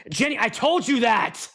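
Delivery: high, exasperated mock scolding; irritated, pitch jumping up; arguing partner. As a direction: imitate a spouse snapping mid-argument. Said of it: "your couples argue with each other".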